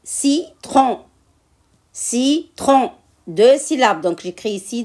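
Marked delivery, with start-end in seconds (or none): 0.0-1.0 "Citron" split as ci-tron, higher pitched; syllable by syllable. Shown as a demonstration. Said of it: "Je découpe les mots de la fiche en syllabes". 1.9-2.9 "Citron" split as ci-tron, higher pitched; syllable by syllable. Shown as a demonstration. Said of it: "Je découpe les mots de la fiche en syllabes".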